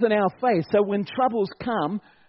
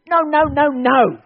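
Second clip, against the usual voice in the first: emphatic no; 5 semitones higher, 7 dB louder than usual. Real, not acted.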